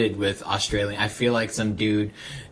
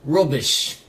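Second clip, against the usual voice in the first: mock British; 4 semitones higher, 4 dB louder than usual. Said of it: "He'd say shit weird".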